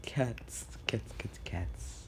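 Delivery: hushed, repetitive murmur of obsession; whispered craving. Retold or imitated. Aizawa fixated on cats, whispered craving. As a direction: mutter it under your breath, fixated.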